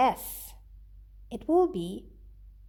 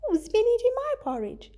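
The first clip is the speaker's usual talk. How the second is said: much higher, sweet motherly voice; sweet Mama Bear; 10 semitones higher than usual. Acted. Teacher reading Goldilocks to her class, as sweet Mama Bear. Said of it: "said in a sweet voice".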